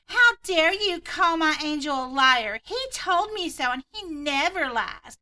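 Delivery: hammed-up entitled mom, slow and high; entitled Karen.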